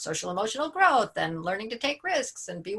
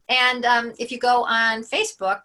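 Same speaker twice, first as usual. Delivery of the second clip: ordinary delivery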